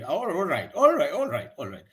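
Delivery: repeated "all right", each falling; hasty compliance. Acted. Employee apologising to sir and agreeing, falling tones. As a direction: rattle off "all right" in downward drops. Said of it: "I'll do that, sir. I'm sorry".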